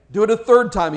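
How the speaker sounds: a commanding call to the people; ordering. A narrator's reading. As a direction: order it with authority. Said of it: "he ordered".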